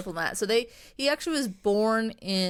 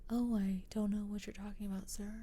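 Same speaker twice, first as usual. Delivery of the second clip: hushed, unassuming damsel voice, much softer; prim and proper; 11 dB quieter than usual. Retold or imitated. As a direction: whisper it like a demure, innocent young lady. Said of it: "very prim and proper".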